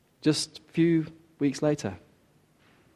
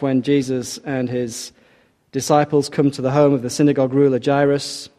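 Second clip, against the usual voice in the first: ordinary talk, nothing marked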